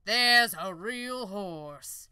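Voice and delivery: loud, slow wondering cry; amazed exclaim. Acted. Onlookers to each other, loud slow amazement. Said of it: "those who saw her exclaimed".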